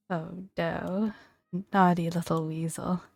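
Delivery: ordinary talk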